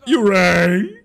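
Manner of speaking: excited anime fan. Acted. Playful quote while watching.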